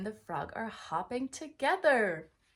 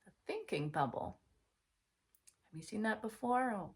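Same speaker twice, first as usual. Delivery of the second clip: usual speaking voice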